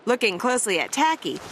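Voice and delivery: plain reading voice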